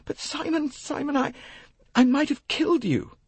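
very high, tearful remorse; horrified young woman. Acted. Jackie acted, horrified at herself.

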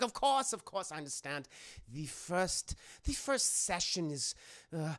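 placating mock German doctor; smooth things over in a comic German accent; Hugo Strange. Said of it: "a very thick German accent".

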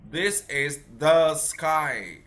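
put-on each word drawn out slowly for a young pupil; slow model sentence. Shown as a demonstration. Teacher slowly models the sentence for his pupil, drawn out. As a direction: spell it out slowly like a patient teacher. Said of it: "con nói lại cho thầy ạ".

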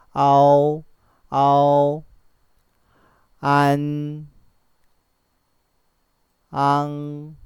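아오, 안, 앙 loud and drawn out on a level pitch; pronunciation model. Shown as a demonstration. Teacher models 아오, 안, 앙 for learners, loud and held. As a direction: sustain each of 아오, 안, 앙, well separated. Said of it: "어 쪽이 좀 가깝게 발음하는 편이".

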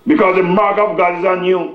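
high, emphatic pulpit warning; preaching. Real, not acted. Preaches to congregation.

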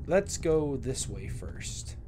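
normal talking voice

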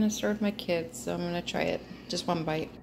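ordinary talk

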